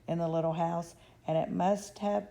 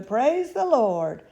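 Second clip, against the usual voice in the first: rejoicing; 6 semitones higher, 10 dB louder, slower than usual. A narrator's reading. Grandpa Joe rejoicing.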